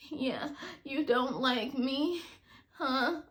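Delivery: slow, soft, sulky put-on voice; his switched voice. Ex imitating the caller's odd whiny voice on the phone. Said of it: "he switched his voice".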